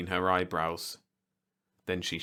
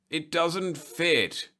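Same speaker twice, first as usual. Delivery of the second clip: slow, plaintive and higher; 10 semitones higher, much slower than usual. A narrator's reading. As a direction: whine it out slowly, self-pitying. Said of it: "he mourned plaintively".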